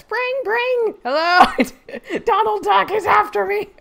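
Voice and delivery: very high, loud duck-like squawk; hammed-up cartoon duck on the phone. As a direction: quack it out like Donald Duck. Mock phone call, Donald Duck joke.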